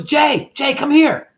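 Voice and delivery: high, urgent retold call; high-pitched summons, acted out; excited calling out. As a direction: imitate someone excitedly calling a friend over. Retold ex-wife calling him over urgently.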